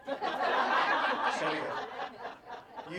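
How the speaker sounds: playful late arriver. Acted. Acting the late high I.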